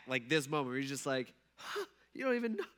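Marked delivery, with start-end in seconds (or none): 1.6-2.6 high-pitched acted exasperation; mock-whiny incredulous pleading; frustrated disbelief. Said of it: "I wonder if Jesus felt like that".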